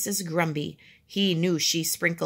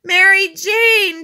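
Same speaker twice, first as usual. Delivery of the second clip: loud, high, drawn-out winded call; breathless desperate shout; panting; 13 semitones higher, 12 dB louder, slower than usual. A narrator's reading. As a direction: call out slowly while short of breath. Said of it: "panted Henry".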